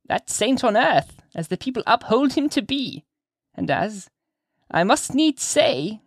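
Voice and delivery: put-on high, slow, swooping old woman's voice; old lady insinuating, pitched high and drawn out; sly old witch-lady.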